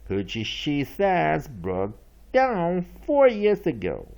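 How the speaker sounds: usual speaking voice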